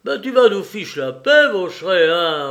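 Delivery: loud, high, exasperated command; sharp order. A narrator's reading. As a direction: shut the henchman up forcefully. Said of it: "ordonna Lupin".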